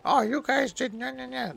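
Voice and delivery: cute mock anger. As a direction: squeal it like a cute character pretending to be mad. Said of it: "like, angry".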